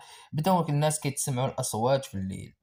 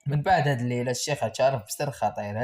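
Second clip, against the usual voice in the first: ordinary delivery, nothing marked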